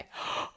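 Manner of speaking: sharp inhale to start a yawn; yawn gasp. Shown as a demonstration. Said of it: "I'll give you a demonstration".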